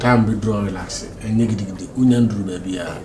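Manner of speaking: soft, quiet calming words; softly reassuring. Real, not acted. Friend calming the worried man about his father, softly. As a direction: settle him down gently.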